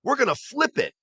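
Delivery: high, eager pitch of a schemer; excited schemer. Retold or imitated. Marketing guy pitching the flipped scheme to the boss, excited and high. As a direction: pitch the big idea, wound up and high. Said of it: "And the guy goes".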